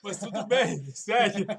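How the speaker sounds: laughing hard through words; cracking up while teasing. Real, not acted. Teasing friend about wrong country.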